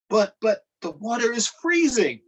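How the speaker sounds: high, stammering frightened protest; scared protest. Acted. Young Harriet protests to the farmer, high and frightened. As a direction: beg off the freezing water. Girl protests icy water.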